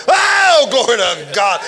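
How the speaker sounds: loud, high cry of praise; preacher preaching himself happy, shouting praise. Real, not acted. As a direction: shout it out, overcome with joy. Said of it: "I'm crying and preaching now".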